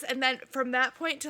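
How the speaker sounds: normal talking voice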